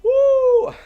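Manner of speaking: very high, loud "woo"; excited whoop. Real, not acted. Vlogger celebrating the big news to viewers, excited whoop. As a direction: let out a piercing cheer. Cheers about the amazing year ahead.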